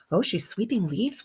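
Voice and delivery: ordinary talk, nothing marked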